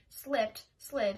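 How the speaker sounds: ordinary delivery, nothing marked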